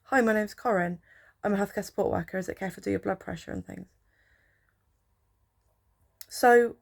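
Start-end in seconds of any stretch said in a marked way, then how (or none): none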